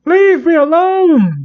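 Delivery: high, slow hammed-up plea; mock frightened cry. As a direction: cry out while running for your life. Fleeing character cries out.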